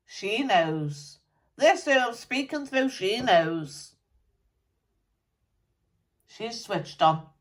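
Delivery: a dead rocker grumbling through a medium; channelled musician spirit. Acted.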